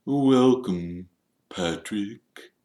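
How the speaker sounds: halting, formal, fading greeting; stiff Nearly Headless Nick. A narrator's reading. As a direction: greet coolly, pausing before the name. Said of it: "said Nick stiffly".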